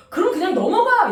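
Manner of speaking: loud high mock-angry shout; telling the fiancé to drop it, shouted; angry retort. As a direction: bark it out high and loud, half laughing. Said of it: "이러는 거야".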